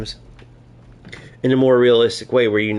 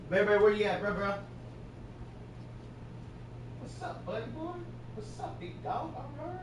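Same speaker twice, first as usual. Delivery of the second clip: pet voice; 8 semitones higher, 18 dB quieter than usual. Real, not acted. Calling the dog in a high, soft sing-song. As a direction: coo at the dog from across the room.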